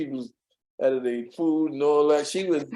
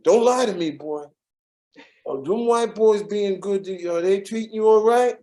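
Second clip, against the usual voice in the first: [name] pressing; 6 semitones higher than usual. Retold or imitated. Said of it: "And I say".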